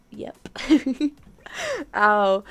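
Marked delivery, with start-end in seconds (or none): none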